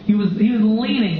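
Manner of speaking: ordinary talk, nothing marked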